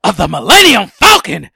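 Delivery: loud, raspy Pacino shout; Al Pacino impression. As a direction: bark it like Pacino. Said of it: "It's like".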